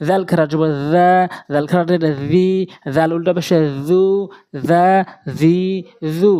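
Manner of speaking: long, level-held vowels in জা, জি, জু; one-alif elongation. Shown as a demonstration. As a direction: hold the vowel of each জা, জি, জু for about two beats. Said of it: "এক আলিফ করে টেনে পড়ব".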